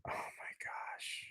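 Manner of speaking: slow, breathless whisper of disbelief; despairing whisper. Real, not acted. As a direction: whisper it like you can't believe it. Stunned fan whispering in despair.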